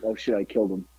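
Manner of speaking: nervous mutter. Retold or imitated. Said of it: "I got nervous".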